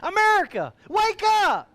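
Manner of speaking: high booming wake-up call; loud wake-up call. Acted. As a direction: rouse the whole nation. Mock morning wake call.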